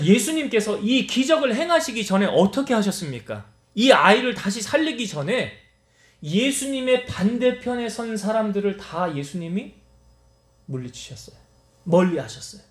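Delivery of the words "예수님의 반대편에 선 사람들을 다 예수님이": normal talking voice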